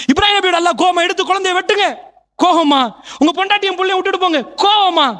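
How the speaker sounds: high, driving sermon questions; fiery preaching. Acted. Preacher asking whether God was angry, fiery and high. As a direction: hammer each question at the crowd.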